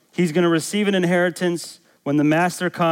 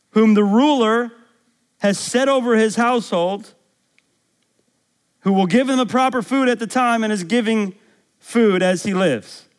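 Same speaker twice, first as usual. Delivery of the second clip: raised, emphatic preaching voice; 8 semitones higher, 4 dB louder than usual. Acted. Preacher puts Jesus's question to the congregation, emphatic preaching. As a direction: proclaim it higher, leaning on key words.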